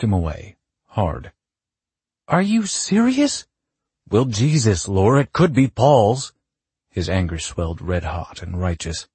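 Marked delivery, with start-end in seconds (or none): 2.3-3.5 high, loud, slow incredulous question; outraged disbelief. Acted. Quarrel after paternity doubt. 4.1-6.3 loud, raised-pitch defensive outburst; angry retort. Acted. Acted quarrel over paternity.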